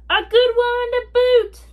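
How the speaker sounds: big hearty pirate shout; pirate crew chorus. Acted. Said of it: "the others agreed".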